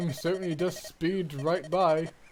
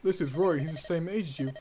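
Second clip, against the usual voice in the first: normal talking voice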